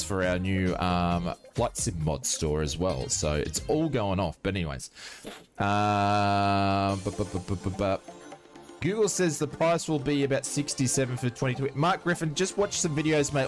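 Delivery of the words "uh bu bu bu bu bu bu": ordinary delivery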